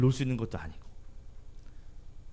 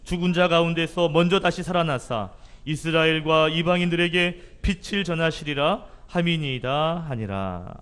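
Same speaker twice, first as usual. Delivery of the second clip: slow, loud, raised solemn reading voice; scripture reading; 4 semitones higher, 6 dB louder, slower than usual. Acted. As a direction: proclaim the verse slowly and loudly. Reads scripture aloud before sermon.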